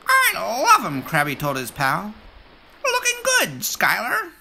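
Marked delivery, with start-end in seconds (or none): 0.1-1.0 high, loud cheerful crab; squeaky little crab pal cheering. Acted. Krabby the crab speaking. 2.8-4.3 squeaky little crab pal cheering. Acted. Krabby the crab speaking.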